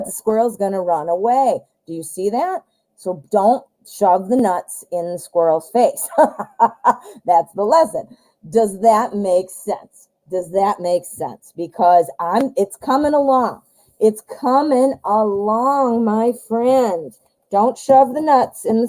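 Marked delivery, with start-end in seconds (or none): none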